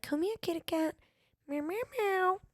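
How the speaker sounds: high, soft, sing-song cooing to a stray cat; tiny high kitty-call, meowing softly. Shown as a demonstration. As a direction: coax the kitty out of the bushes with a high little meow. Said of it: "my cat voice or whatever".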